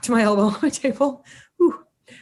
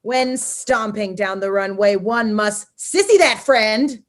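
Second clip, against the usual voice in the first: annoying voice. Pretend. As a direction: read it like an annoying, pompous manifesto. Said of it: "read it in this annoying voice".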